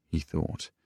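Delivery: ordinary delivery, nothing marked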